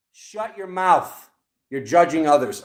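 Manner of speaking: loud, riled scolding; fired up. Real, not acted. Reader scolding those who judge, loud riled outburst. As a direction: scold them loudly, worked up. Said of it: "now my heart's racing".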